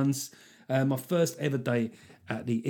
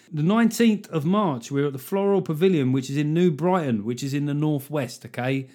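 normal talking voice

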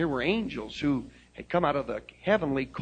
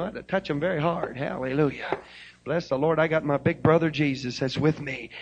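usual speaking voice

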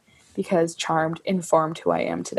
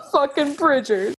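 excited; 6 semitones higher than usual. Real, not acted. Naming her favourite album, overcome.

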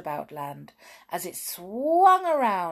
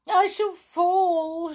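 high, drawn-out storybook wail; acted tearful cry, pitched way up; weeping; 12 semitones higher, 6 dB louder, slower than usual. A narrator's reading. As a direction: wail it like a tearful little girl. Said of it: "she wept".

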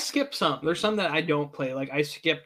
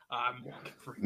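deep, soft narrator voice; softer deep narrator impression; Morgan Freeman impression; 3 semitones lower, 10 dB quieter than usual. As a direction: drop it low and hushed, like a movie narrator. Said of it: "Nailed it".

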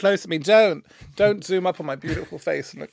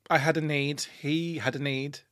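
slow dramatic; 5 dB quieter, slower than usual. Acted. Mock dramatic confession.

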